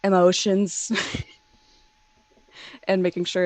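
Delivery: ordinary talk, nothing marked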